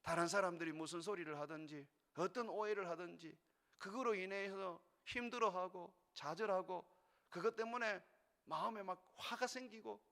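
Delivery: ordinary talk, nothing marked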